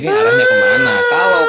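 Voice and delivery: high, loud angry shout. Real, not acted. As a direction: yell it at him. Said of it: "tenang, Bang".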